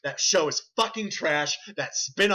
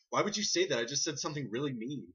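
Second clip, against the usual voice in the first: out of character; 3 semitones lower, 7 dB quieter, faster than usual. Retold or imitated. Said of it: "he can't get into character".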